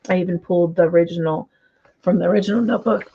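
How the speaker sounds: losing voice, rough and strained; hoarse voice. Real, not acted. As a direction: push through a hoarse, failing throat. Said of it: "Losing my voice".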